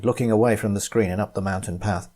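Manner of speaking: usual speaking voice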